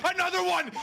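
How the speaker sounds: high, loud shouted call; excited shout. Real, not acted. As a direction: yell it as the play lands. Caster hype during clutch.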